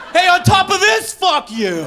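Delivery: high shouting of a rude driver; hammed-up shouted insult; mock yell. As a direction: yell it like a fleeing jerk. Mimics hit-and-run driver.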